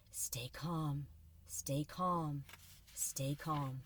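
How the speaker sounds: soft, low whispered mantra; self-calming whisper. Acted. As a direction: whisper it to yourself, holding still. Mel calming herself.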